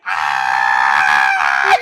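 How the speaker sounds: sudden loud low yelp; startled scream. Retold or imitated. Friend shrieking as her cheek is licked, loud yelp. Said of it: "she was like".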